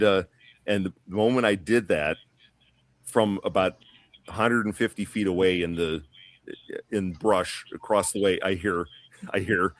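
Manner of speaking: normal talking voice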